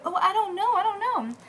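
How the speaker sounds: acted innocent denial. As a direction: play clueless in a high voice. Employer feigns ignorance to searchers.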